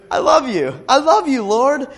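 high, loud cry of devotion; loud joyful cry of love. Acted. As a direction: lift it up and cry it out to God. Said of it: "he cries out".

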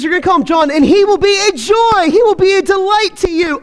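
a touch of proclamation, lifted; angel's announcement. Acted. Angel's prophecy voiced in reading.

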